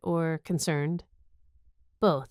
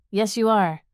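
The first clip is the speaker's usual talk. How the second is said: cheerful; 4 semitones higher, 6 dB louder than usual. A narrator's reading. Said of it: "the wall agreed cheerfully".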